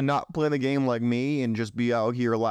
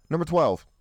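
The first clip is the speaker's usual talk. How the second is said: normal talking voice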